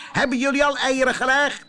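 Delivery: high, loud, fast demand; shouting. Acted. Rooster yells at the hens for eggs, high and fast. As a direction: yell for the eggs. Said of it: "schreeuwde hij".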